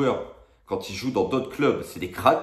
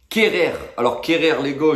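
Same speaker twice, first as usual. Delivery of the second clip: loud announcement; 6 semitones higher, 6 dB louder than usual. Real, not acted. Annonce du joueur, ton montant.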